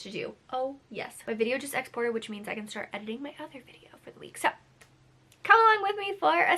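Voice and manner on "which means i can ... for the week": quick unvoiced whisper, fast; hushed fast aside. Real, not acted. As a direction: rush it out as a whisper under the breath. Aside while video exports.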